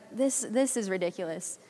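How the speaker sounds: softly scoffing inner voice; quiet scoff. Retold or imitated. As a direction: mutter it under your breath as a sceptic. Quoting her own skeptical thought.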